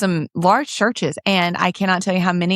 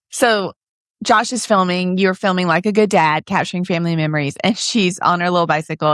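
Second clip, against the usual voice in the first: usual speaking voice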